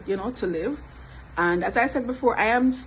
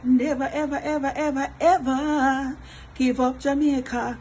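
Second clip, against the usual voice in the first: "never, ever, ever" chanted higher, the last "ever" stretched long; emphatic drawn-out vow; 4 semitones higher than usual. Real, not acted. Vlogger vowing loyalty to Jamaica, playfully emphatic. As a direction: pile up each "ever", then hold the final one.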